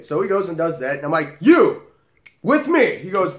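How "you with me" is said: clipped order, much higher and louder; sharp command. Acted. Bouncer summoning the drink spiker, loud command. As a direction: call him out with no room to argue.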